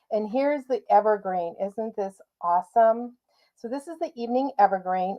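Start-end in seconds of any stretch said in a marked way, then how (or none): none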